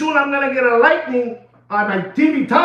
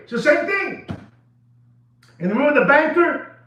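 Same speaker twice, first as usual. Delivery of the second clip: ordinary talk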